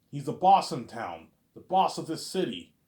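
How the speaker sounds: loud, slow, weighty warning; ominous boss warning. Retold or imitated. Cop warns kid about the city boss, loud slow. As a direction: lean on it like a menacing henchman. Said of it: "cop describes Agent Smith".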